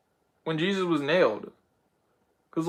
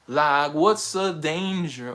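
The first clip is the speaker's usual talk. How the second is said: even slower, settling down; slower, falling repeat; much slower than usual. Retold or imitated. Miles repeating himself, slower and falling. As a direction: let it drop and linger. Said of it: "Miles just jumped out of nowhere and was like".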